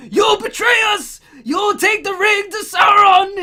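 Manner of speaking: loud, high theatrical accusation; hammed-up cry of betrayal; mock Boromir shout. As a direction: bellow it like a raving warrior. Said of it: "And then he says".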